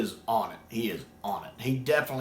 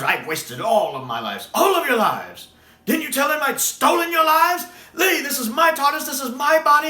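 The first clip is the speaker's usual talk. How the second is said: rising to a high, loud outburst; reenacted Master rant; 13 semitones higher, 7 dB louder than usual. Fan reenacting the Master's scene, climbing into a high loud cry.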